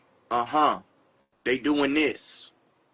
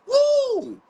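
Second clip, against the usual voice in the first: very high falsetto "woo"; high whoop; 4 dB louder than usual. Retold or imitated. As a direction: whoop high like a pop singer. Whoop after singing the hook.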